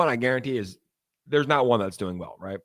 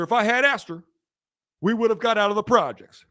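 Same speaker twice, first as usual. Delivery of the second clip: higher-pitched impersonation of a presidential orator; Barack Obama; 6 semitones higher, 3 dB louder than usual. Acted. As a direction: deliver it as a stump speech in measured phrases, voice raised. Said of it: "I can also do a Barack Obama".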